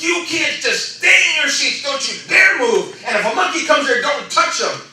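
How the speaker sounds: high, emphatic scolding order; retold yelling. Trainer warning schoolkids off escaped monkeys, retold yelling. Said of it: "he's yelling at us".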